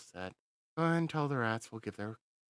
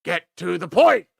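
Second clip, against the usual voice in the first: loud, higher, furious shout; impatient yell; 11 semitones higher, 16 dB louder than usual. Acted. Commander yelling at the slow major. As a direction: bark at him to hurry up. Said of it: "Why are you talking so slow?".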